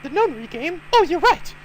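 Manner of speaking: put-on loud, high girly voice; high ditzy girl.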